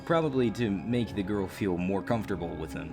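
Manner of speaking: normal talking voice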